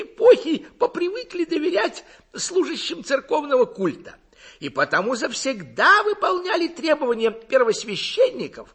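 high, loud, burbling lecturer's voice; carried-away orator. A narrator's reading. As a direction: warble on in full oratorical flight, high and loud. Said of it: "он неистово жестикулировал и заливался соловьём".

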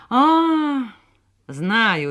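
high "ah", then sure of it; confident exclamation. A narrator's reading. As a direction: exclaim with certainty. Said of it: "уверенно воскликнул он". (уверенное восклицание)

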